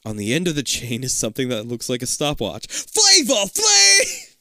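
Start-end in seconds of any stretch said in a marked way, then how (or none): 2.7-4.4 very high, loud, stretched yell; hype-man shout. Retold or imitated. Reader joking about the big neck clock, hype shout. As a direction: holler it out long and high.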